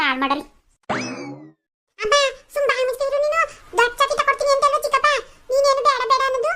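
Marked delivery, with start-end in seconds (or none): 2.0-6.5 put-on very high-pitched little-child voice; insistent child. Child tells mother to stop objecting, insistently. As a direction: talk back as a small child set on treats.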